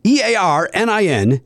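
E A R N I N, slow letter by letter; spelling it out. Shown as a demonstration. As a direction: spell each letter slowly. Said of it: "let me spell that for you".

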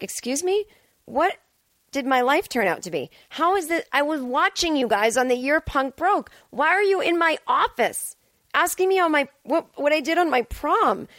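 retold starstruck disbelief; incredulous disbelief. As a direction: act floored that a rock star asks this. Incredulous at [name]'s question.

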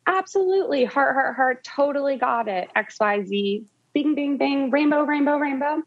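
high, bubbly over-the-top reply; gushing text voice. Retold or imitated. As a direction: read the text like it's all emojis. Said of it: "It was like".

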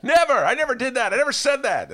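hammed-up self denial; mock indignant denial. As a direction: deny it loudly, mock outraged. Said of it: "I have been known to do that".

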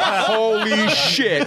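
very high, drawn-out amazement; starstruck awe. Retold or imitated. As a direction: stretch each word in mock disbelief. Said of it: "the most starstruck I had ever seen".

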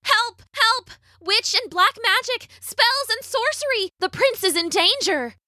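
high-pitched urgent cries; yelling for help. A narrator's reading. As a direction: call the alarm in a high girlish voice. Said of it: "began to yell".

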